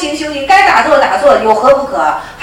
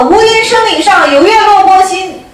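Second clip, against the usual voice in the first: slow, higher, shouted verse; loud shout; 6 semitones higher, 3 dB louder than usual. Retold or imitated. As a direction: bellow the verse like a master's wake-up cry. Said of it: "大声喝".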